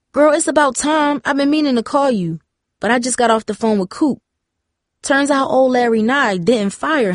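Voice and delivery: lively, gossipy phone voice; chatty girlfriend. Acted. Tara sharing news with Darcy by phone, lively and gossipy. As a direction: dish the news to a close friend.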